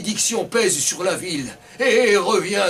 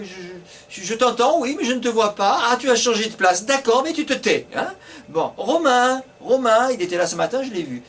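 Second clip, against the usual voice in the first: higher, bossy teacher calling the roll; 5 semitones higher than usual. Acted. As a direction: hush the class and call names.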